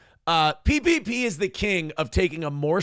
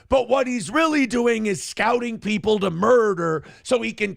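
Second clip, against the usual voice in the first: imitating PPP's rant; 4 semitones higher than usual. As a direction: shout the wild murder theory like breaking news. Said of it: "and go".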